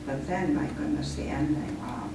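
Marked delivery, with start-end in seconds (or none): none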